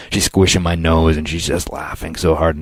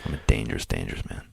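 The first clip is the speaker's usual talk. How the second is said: low, soft menacing growl of dangerous man; mock tough guy; 10 semitones lower, 9 dB quieter, faster than usual. Co-host playing bodyguard, hushed menacing boast. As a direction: drop low and quiet like a movie heavy. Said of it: "joking tough-guy boast".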